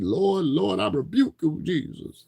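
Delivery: put-on high, loud pleading old man, fading into a whisper at the end; mock old man praying in a panic, Jesus breathed out. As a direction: cry out to the Lord like a frightened old man. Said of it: "Like you heard the old man in the video".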